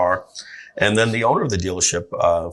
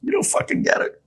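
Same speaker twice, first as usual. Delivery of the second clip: crying outburst. Retold or imitated. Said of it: "I'm crying and I'm going".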